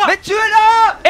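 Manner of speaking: high, slow yelling plea; frantic shout. Real, not acted. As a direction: yell it out, dragging it. Urging teammates in fight.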